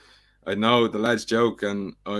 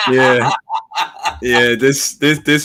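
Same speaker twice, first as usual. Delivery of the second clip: laughing; 3 semitones higher, 8 dB louder, much slower than usual. Real, not acted. Still laughing after the bald joke.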